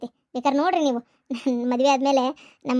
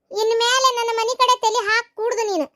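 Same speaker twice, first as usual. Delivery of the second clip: high, raised angry telling-off; sharp raised scolding; angry warning; 6 semitones higher than usual. Acted. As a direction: order her off your doorstep. Throwing the in-law out.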